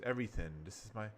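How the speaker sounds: ordinary talk, nothing marked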